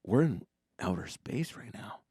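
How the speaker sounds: hushed, dawning worry; anxious realization. Retold or imitated. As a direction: murmur it as panic creeps in. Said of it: "an anxiety attack".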